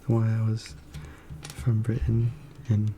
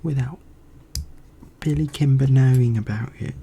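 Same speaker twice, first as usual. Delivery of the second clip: British accent; 3 semitones higher, slower than usual. Shown as a demonstration. Streamer showing off an accent to viewers, British accent. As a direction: declare it in a British accent, phrase by phrase. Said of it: "there's that British accent".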